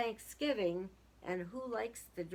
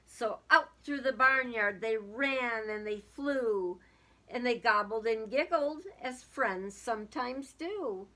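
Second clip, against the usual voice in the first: lively storybook reading aloud; sing-song children's story voice; 6 dB louder than usual. A narrator's reading. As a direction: read it like a rhyming picture book to kids. Reading a picture book aloud.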